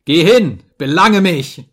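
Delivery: loud, high angry challenge; furious, punched commands; shouted dare. A narrator's reading. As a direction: yell it as a defiant dare. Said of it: "schrie ich".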